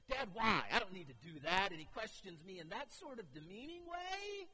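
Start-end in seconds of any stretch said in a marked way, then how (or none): 0.1-1.7 loud, high talking back like a cheeky son; defiant kid. Retold or imitated. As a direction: sass dad loudly about crossing. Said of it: "he looks and says".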